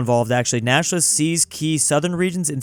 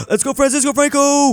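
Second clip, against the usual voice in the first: gasping, much higher, hyped cheer; excited gasp; 11 semitones higher, 3 dB louder than usual. Real, not acted. Gamer cheering Franco's uprising, high excited gasp.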